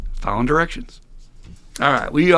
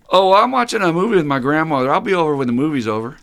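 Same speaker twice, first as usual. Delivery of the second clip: higher, louder retold excuse, rattled off; imitated excuse; 5 semitones higher, 6 dB louder than usual. Sponsee excusing himself to his waiting sponsor, mock casual. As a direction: mimic a sponsee breezily begging off.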